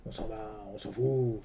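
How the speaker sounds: very slow, low, soft Bedos voice; lightly voiced Guy Bedos, indifferent; Bedos impression. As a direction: drag it out like the old comedian. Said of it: "ça, j'imite Bedos".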